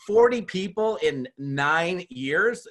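high, slow, stretched disbelief; incredulous. Retold or imitated. Host repeating a caller's number back in slow disbelief. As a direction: drag it out like you can't believe the number.

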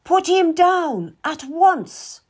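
high, loud and slow order; furious command. Acted. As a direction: scream an order to drop the dog. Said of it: "Anne screamed at him".

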